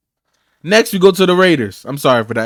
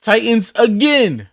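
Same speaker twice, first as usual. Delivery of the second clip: ordinary talk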